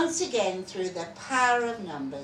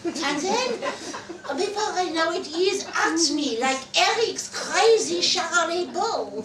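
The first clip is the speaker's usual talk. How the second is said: giggling through the words, louder; laughing; 8 semitones higher, 7 dB louder than usual. Acted. Delphine giggles telling of her husband's pounce, laughing aloud. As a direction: tell it while laughing.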